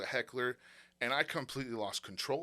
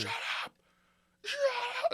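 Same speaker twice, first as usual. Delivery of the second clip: hushed seething shut up; much faster than usual. Retold or imitated. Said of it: "I lost it".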